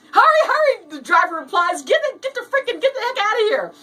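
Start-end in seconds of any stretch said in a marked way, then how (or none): 0.1-0.9 high, loud comic panic; frantic urging. Acted. Driver urging passenger in a panic. 1.8-3.7 high, stumbling panic; frantic urging. Acted. Driver urging escape in a panic.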